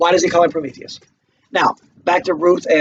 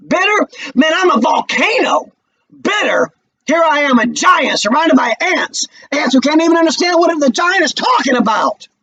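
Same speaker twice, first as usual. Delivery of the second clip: Walter's bitter outburst; 8 semitones higher than usual. Acted. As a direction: erupt like a volcano. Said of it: "Intently, almost quietly".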